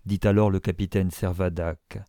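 ordinary delivery

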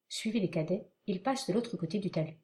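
ordinary talk, nothing marked

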